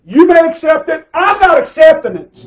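high, loud pulpit refusal; defiant preaching shout. Real, not acted. Preacher refusing to accept it before the congregation, defiant shout.

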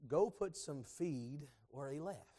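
low, slow and soft, still voice; the Lord's quiet voice. Retold or imitated. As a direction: give the Lord's word softly. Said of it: "I felt like the Lord said".

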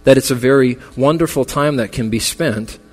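rally the mourners toward eternity's hope; preaching. Acted. Funeral sermon building to preaching.

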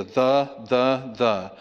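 each "the" a separate, weighty word; stress on THE. Shown as a demonstration. Preacher points out the Greek article, stressing "the". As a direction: say "the" as "thuh", fully stressed. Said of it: "One, two, three, four definite articles".

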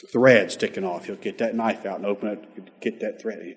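usual speaking voice

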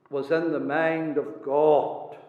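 ordinary talk, nothing marked

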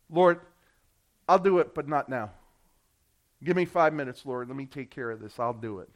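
low, mumbled excuses; mock procrastinating prayer. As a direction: brush God off half-heartedly, trailing away. Parody of reluctant believer.

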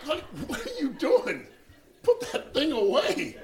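laughing plea. Retold or imitated. Husband to wife holding a gun, laughing plea. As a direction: laugh while telling her to stop. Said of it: "He's like".

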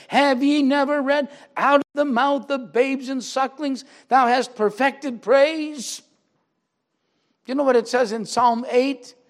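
loud, high, ringing preacher's voice; full-force preaching. Acted. Preacher thundering Jesus's rebuke to the congregation, loud and high.

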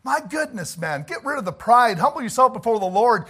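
loud, high, impassioned shout; preaching at full force. Real, not acted. Preacher to his flock, pleading in a loud high shout. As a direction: hammer it home to the congregation.

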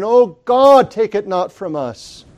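loud high cry of Oh God, then pleading. Retold or imitated. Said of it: "he would plead in the sermon".

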